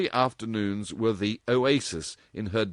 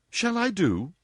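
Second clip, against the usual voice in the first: put-on cheeky, challenging girl's question, higher; impudent; 12 semitones higher, 4 dB louder than usual. A narrator's reading. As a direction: toss it out impudently. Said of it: "she demanded impudently".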